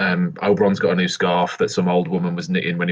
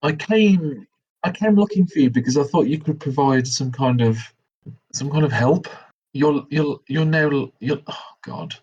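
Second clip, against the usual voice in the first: exasperated companion; 7 semitones higher than usual. Acted. Character berates his filthy companion.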